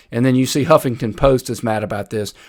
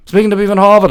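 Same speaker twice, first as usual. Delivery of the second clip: louder, higher, quick put-on Harvard accent; mock Harvard accent; 7 semitones higher, 7 dB louder, faster than usual. Host ribbing a candidate's Pittsburgh voice, mock Harvard accent. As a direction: put on a mocking Ivy League Harvard accent. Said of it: "she's on the campus at Harvard".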